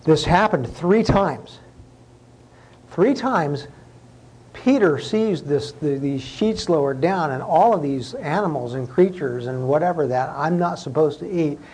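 ordinary delivery

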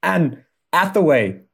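a light, faked English accent; gushing English girl, half-hearted; bad English accent. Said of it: "I can't do this accent for very long".

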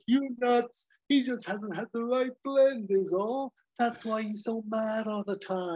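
smug over-the-top pitch; Hawk explaining. Retold or imitated. Hawk explaining his coffee plan, hammed high. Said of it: "And, uh, Hawk's like".

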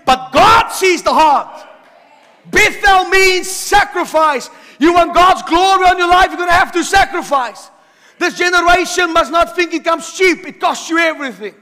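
fiery pulpit climax; preaching at full force. Acted. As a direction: drive it home like a revival preacher.

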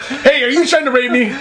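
high, hammed-up accusing voice; paranoid panic. Imagined clubgoer looking around at strangers, mock panic.

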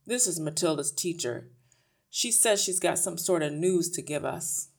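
loud, offhand ratty man; gruff husband. A narrator's reading. Husband introduces the teacher to his wife, gruff. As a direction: introduce her loudly and grudgingly.

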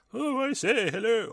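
high, loud caricature of a posh Brit saying hello; exaggerated mock British toff; over-the-top British accent. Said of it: "I don't want it to be like".

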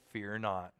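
soft, low hushed fear not; a mock solemn angel hush. Angel calming the shepherds, hushed.

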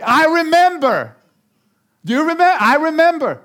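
high, loud indignant accusing; acted spouse fight. Spouse hitting back in a fight, loud and indignant. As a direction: fire back like a wounded spouse.

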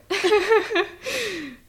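quick high-pitched laugh. Real, not acted. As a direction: giggles up high. Laughing at Oh boy.

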